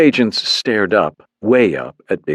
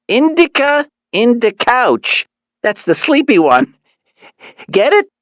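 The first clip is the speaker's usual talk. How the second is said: Mom voice; 4 dB louder than usual. A narrator's reading. Mom's mnemonic.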